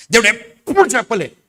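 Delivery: loud, high, fiery declaration; preaching at full force. Acted. Pastor insists God never promised early death, loud preaching. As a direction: thunder it to the church.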